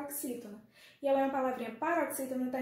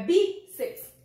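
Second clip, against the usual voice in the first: bíceps with a loud, drawn-out BI; stress on first syllable; 5 semitones higher, 9 dB louder than usual. Shown as a demonstration. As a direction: hit BI in bíceps, then drop off. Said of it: "Nós temos o bi como a sílaba tônica".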